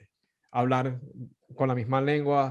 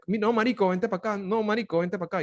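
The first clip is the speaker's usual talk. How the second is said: Venezuelan buddy; 7 semitones higher than usual. Retold or imitated.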